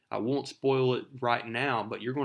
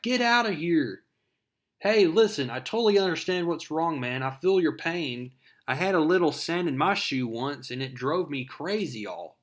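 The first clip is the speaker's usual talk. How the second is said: higher and louder, a quick wisecracking hero voice; cocky Spider-Man quip; 4 semitones higher, 4 dB louder than usual. Retold or imitated. Spider-Man taunting Sandman mid-fight, higher wisecracking voice. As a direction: taunt the villain like a smart-mouthed teen hero.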